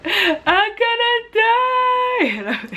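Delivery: high, drawn-out whiny wail, breaking into laughter; mock wailing. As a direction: wail it like a melodramatic child, laughing at yourself. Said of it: "And then I was like".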